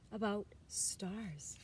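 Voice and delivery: hushed bedtime whisper. A narrator's reading. Mother starts a bedtime story, whispered.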